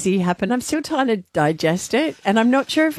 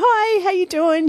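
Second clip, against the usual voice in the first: higher, slow, extra-friendly hello; hospitable greeting; 9 semitones higher than usual. Retold or imitated. Host greeting a fan, mock hospitable. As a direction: sing out a gracious welcome. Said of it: "I'm being really hospitable".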